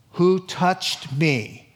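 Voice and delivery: very slow, weighted question; deliberate question. Acted. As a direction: draw out each word with patient emphasis.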